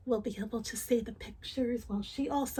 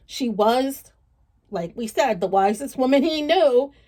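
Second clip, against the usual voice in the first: louder, lively read-aloud storytelling; story narration; 7 dB louder than usual. Acted. Reading a folk tale to children, lively narration. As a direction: tell it with energy to a child.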